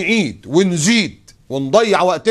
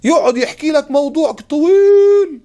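slow, high and louder, stretching طويل long; mock the endless storyteller, dragging طويل; drawn-out mock complaint; 11 semitones higher, 6 dB louder, slower than usual. Mocking long-winded storytelling. (بطء ساخر مع مد كلمة طويل)

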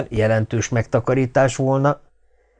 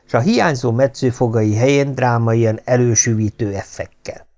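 usual speaking voice

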